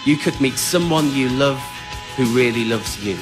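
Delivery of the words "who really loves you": usual speaking voice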